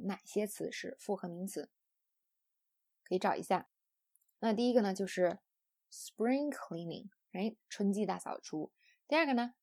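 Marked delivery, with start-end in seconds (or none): 5.9-7.1 slow demo with the stress on "spring"; compound-noun stress. Shown as a demonstration. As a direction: stress "spring", let "cleaning" follow lighter. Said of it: "复合名词重音在第一个单词上".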